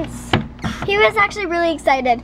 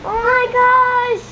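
excited; 8 semitones higher, much slower than usual. Real, not acted. Sees tooth, fond amazement.

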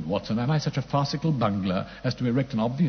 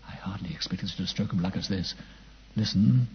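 ordinary delivery

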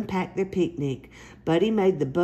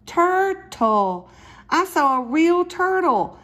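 excited; 10 semitones higher, 5 dB louder, slower than usual. Acted. Child wakes, sees turtle.

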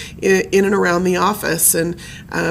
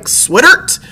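gruff grandpa's slurred bellow, higher and louder; bellowed nickname; 6 semitones higher, 6 dB louder than usual. Retold or imitated. Granddaughter imitating how grandfather called his wife, bellowed. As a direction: bellow "Sweatert" as one run-together word. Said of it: "kind of bellow it".

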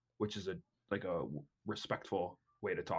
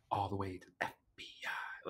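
put-on whispered Southern accent; 4 dB quieter than usual. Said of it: "he does do the Southern accent".

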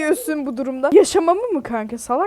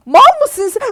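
mocking; 8 semitones higher, 7 dB louder than usual. Real, not acted. Mocking friend in game.

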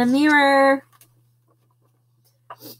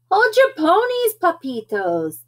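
high, loud little-guy voice; high-pitched bossy pipsqueak; tiny character declaring; 7 semitones higher, 3 dB louder than usual. A narrator's reading. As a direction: declare it like the smallest of the gang. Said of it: "declared Poquito Tito, the smallest of the small ones".

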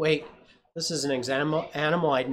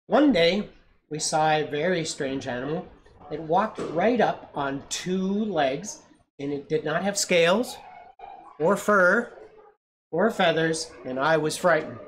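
lively read-aloud, louder on the scary bits; story narration; 3 semitones higher, 5 dB louder, slower than usual. A narrator's reading. Parent reading to a child, building suspense.

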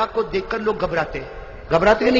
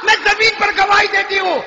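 rising shouted testimony; 12 semitones higher, 5 dB louder than usual. Acted. Mother testifies he is a prophet.

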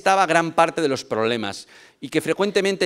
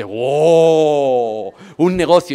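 loud, amazed crowd cheer; the audience reacts to the business gift, loudly amazed; crowd wow; 10 dB louder, much slower than usual. Retold or imitated. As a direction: gasp it out like an impressed audience.

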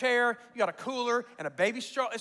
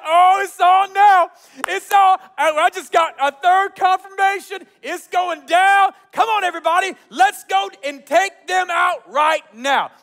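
fired-up battle call; 6 semitones higher, 10 dB louder than usual. Retold or imitated. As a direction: rouse three hundred men to attack. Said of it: "He's like".

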